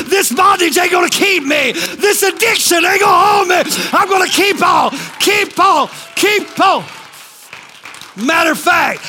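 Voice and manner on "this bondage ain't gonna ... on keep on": high, defiant sermon shout; fired-up testifying cry; full-force preaching. Real, not acted. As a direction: declare it with mounting pulpit fervor.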